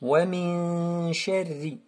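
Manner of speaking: long "min", thin light "sharri"; slow "Wa min sharri", keeping the ra fine; light ra recitation. Shown as a demonstration. Teacher models for students, light ra in "sharri". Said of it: "fouilletée".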